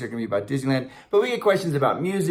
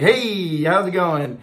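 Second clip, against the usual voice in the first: slick salesman greeting, higher and drawn-out; used car salesman; 4 semitones higher, 3 dB louder, much slower than usual. Retold or imitated. Imitating the actor's Hades as slick guy, salesman voice. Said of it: "like, this used car salesman".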